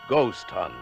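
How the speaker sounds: normal talking voice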